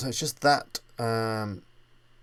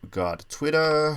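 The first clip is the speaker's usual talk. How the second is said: slow, drawn-out, hammed-up American accent, a bit higher and louder; bad American accent; 6 semitones higher, 5 dB louder, slower than usual. Tutorial host jokingly reads out Twitter in a bad American accent. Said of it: "I have to say that in American accent".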